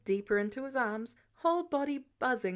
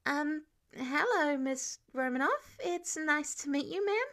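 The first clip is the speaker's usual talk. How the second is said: nervous greeting; 4 semitones higher than usual. A narrator's reading. Peter nervously greets Natasha.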